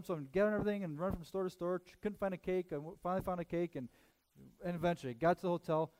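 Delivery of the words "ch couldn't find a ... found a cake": ordinary talk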